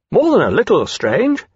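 high, swooping disdain; indignant young dragon. Acted. Dragon Caesar chimes in on Temeraire's doubt, indignant. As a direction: butt in with pert scorn.

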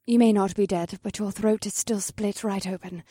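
soft, low gentle care. A narrator's reading. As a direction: tend to a wounded friend softly. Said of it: "in a surprisingly gentle tone".

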